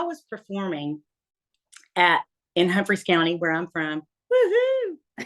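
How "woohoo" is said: high, whooping woohoo; hometown cheer. Real, not acted. Cheering her home county with a high whoop.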